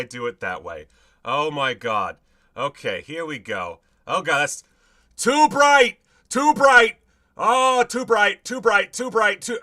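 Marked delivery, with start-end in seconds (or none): 4.1-9.6 yelping too bright, high and loud; squinting, high yelps at the brightness; pained yelps. Real, not acted. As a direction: cry out at the glaring light. Bright light hits, he recoils.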